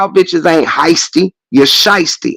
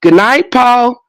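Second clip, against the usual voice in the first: high, sugary family goodnight; Waltons family goodnight; 6 semitones higher, slower than usual. Retold or imitated. Mocking The Waltons family with a high sugary goodnight. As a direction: coo it like a sweet TV family. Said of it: "The Waltons".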